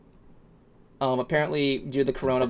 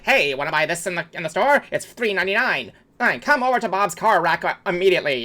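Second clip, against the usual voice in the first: higher-pitched salesman pitch; advertising voice; 5 semitones higher, 3 dB louder than usual. Shown as a demonstration. Showing the chat his old advertising voice, advertising pitch. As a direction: pitch it like a car ad. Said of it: "you guys know my advertising voice".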